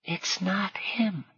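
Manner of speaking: slow, hushed stage whisper; slow whispered aside; loud whisper. A narrator's reading. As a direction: whisper it so a companion hears. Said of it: "said in a loud whisper".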